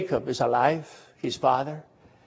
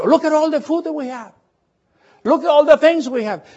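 much higher, proudly showing off the food; boastful brothers; 10 semitones higher, 3 dB louder than usual. Retold or imitated. Brothers showing off the food to their father, excited and much higher. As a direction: brag like sons home with riches.

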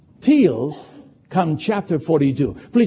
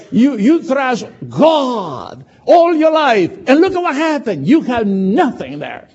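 scolding wife; 7 semitones higher, 3 dB louder than usual. Acted. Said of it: "She, she said".

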